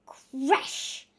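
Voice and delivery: usual speaking voice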